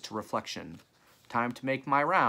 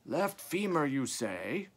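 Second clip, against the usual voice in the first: lightly voiced old croaker; high start on an old man's query; a hint of an ancient croak; 5 semitones higher, slower than usual. A narrator's reading. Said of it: "came an ancient croaking voice from nearby".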